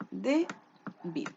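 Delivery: Débil split into DE, pause, BIL; syllable by syllable. Shown as a demonstration. Teacher counting two syllables, Débil broken apart. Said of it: "¿Dónde se carga la voz?".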